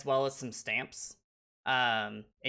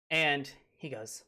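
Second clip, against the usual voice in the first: ordinary delivery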